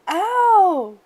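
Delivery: high, loud ow of pain; sharp pained ow; cried out. A narrator's reading. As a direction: yelp at the hot soup. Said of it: "she cried out".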